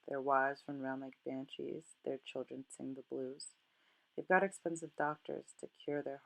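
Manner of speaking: soft, measured poetry reading; poem recitation. Acted. Teacher reads stanzas on the rich and lonely, soft poem recitation. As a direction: recite quietly, letting each phrase settle.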